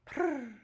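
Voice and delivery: a quiet imitated purring sound; soft purr. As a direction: purr softly like a contented baby dragon. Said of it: "a sweet little purr".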